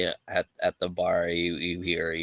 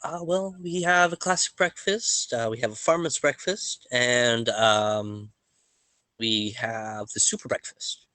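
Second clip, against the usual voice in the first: much higher, singsong waitress reading the menu; 4 semitones higher than usual. Acted. Waitress listing the breakfasts to the travellers. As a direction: rattle off the menu like a cheery server.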